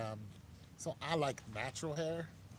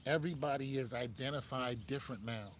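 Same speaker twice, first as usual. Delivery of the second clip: ordinary talk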